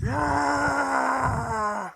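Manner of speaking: very high, loud cheering cry from the crowd. Acted. Undead crowd answering their leader with a high, loud cry. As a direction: let out a wild battle cheer. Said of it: "you hear this".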